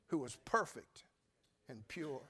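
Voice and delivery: ordinary talk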